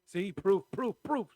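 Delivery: loud, high gloating chant; teasing proof. Real, not acted. Friend teasing her pal for being cranky, loud and gloating.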